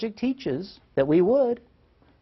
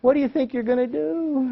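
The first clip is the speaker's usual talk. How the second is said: put-on higher-pitched little kid's coaxing question; imitated three-year-old; 8 semitones higher than usual. Three-year-old asks frustrated mom her plan, imitated childlike concern. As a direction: ask it high, playing the small child counselor. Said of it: "And he said".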